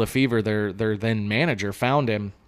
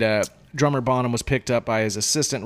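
ordinary talk

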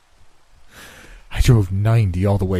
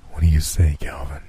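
lower, measured voice of Death; Death's voice; 4 semitones lower than usual. Acted. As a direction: speak as calm, cold Death. Death asks Calvin.

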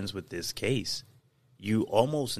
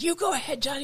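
high-pitched imitation of a taunt; acted goading, pitched way up; mock taunting woman; faster than usual. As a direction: dare them in a put-on high voice. Imitates her taunting Johnny in recording.

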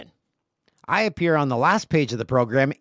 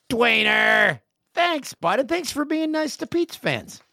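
much higher, bright enthusiastic call; excited; 12 semitones higher, slower than usual. Real, not acted. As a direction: cheer his name. Excited shout to cohost.

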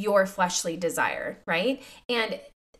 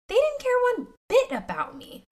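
much higher, anguished exclaim; pained cry. Retold or imitated. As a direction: let the hurt jump up high. Reliving her younger self's realization.

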